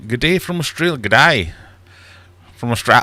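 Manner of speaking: lightly hammed-up Aussie greeting; Australian accent. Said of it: "Why do I keep trying to do accents?".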